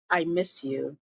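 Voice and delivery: husband softly pleading to his wife. Retold or imitated. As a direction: breathe it to her longingly. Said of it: "he was like".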